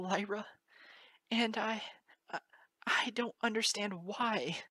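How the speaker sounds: fighting back tears; voice breaking. Acted. Moon Dancer to Lyra about her father, tearful.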